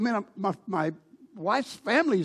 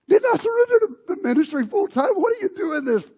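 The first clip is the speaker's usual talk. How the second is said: anguished cry; 11 semitones higher, 6 dB louder than usual. Retold or imitated. A pastor crying out to God.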